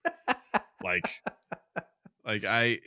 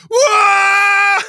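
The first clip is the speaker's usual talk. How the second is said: much higher, much louder joyful shout; excited whoa; 13 dB louder than usual. Retold or imitated. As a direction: yell it like two dogs spotting a new best friend. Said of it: "Bruno and Benny would just be like".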